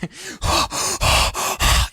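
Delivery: loud mock gasps into the mic; exaggerated gasping. Coach facetiously demonstrating breathing into the mic, exaggerated gasps. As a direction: heave big, put-on breaths close to the mic. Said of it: "I'm being facetious here".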